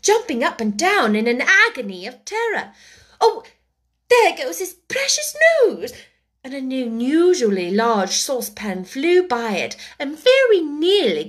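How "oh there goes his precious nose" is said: put-on very high, alarmed girl; frightened cry. A narrator's reading. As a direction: shriek in alarm. Pans flying, Alice terrified.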